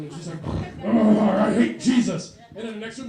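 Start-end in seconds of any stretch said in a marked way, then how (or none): 0.8-2.4 louder, slow possessed bellow; demonic roar.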